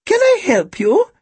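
very high, slow, thin voice; elderly shopkeeper's high trill; thin, high-pitched old woman. A narrator's reading. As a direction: offer help like a birdlike old lady. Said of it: "Her voice was thin and high-pitched".

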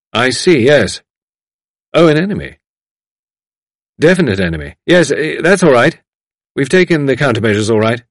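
brief replies, as on the phone; telephone conversation. A narrator's reading. Man on telephone taking a warning, responding attentively.

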